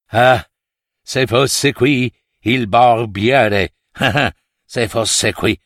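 slow, drawn-out lament with a little laugh; wistful king. Acted. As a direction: play a beaten king longing for help.

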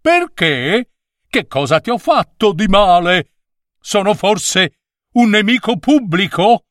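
voce acuta e risentita del numero nove; un piccolo numero permaloso che si lamenta; il nove offeso. A narrator's reading. Voice of the offended nine. (il nove rimprovera lo scolaro, voce alta e offesa)

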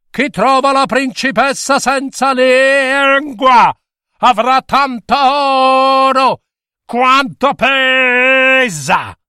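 royal proclamation shout. A narrator's reading. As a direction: boom it across the kingdom like a king. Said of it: "salì in cima alle montagne e gridò". (bando urlato dal re, voce alta e lenta)

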